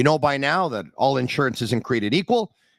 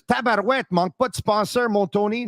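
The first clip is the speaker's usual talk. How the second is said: a high, playful Québécois exclamation read from chat; Quebec French exclamation; 7 semitones higher than usual. Retold or imitated. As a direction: toss off the Quebec French phrase high and playful, imitating the listener. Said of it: "He says".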